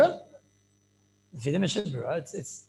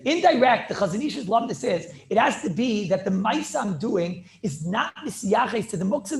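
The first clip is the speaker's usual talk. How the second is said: ordinary delivery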